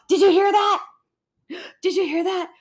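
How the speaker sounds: high, spooked, gasping alarm; mock scared. Streamer imitating Amber spooked by sounds, mock scared. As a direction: imitate a jumpy friend startled by a noise. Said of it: "that's what she gets scared of".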